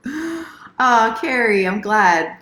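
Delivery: bright happy exclamation; delighted to see her; excited greeting. Real, not acted.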